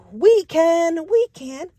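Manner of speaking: high, eager shout of we can; eager answer. Acted. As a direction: chirp it like keen helpers.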